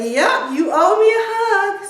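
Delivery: loud, high, gleeful demand; insistent daughter. Retold or imitated. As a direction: sing out the claim as the daughter. Daughter insists on her hug.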